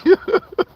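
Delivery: short high laugh. Real, not acted. Laugh between the repeats.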